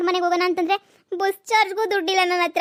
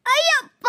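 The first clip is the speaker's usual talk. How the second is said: sudden high pained cry; yelp of pain; 10 semitones higher, slower than usual. Acted. Man kicked by the cow yelps high in pain.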